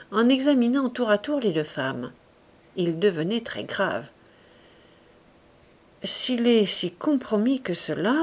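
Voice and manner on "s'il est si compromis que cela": ordinary delivery, nothing marked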